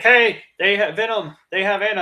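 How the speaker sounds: mock Eddie raising the alarm; imitating Eddie. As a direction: call out in a panic. Eddie voiced, retelling movie.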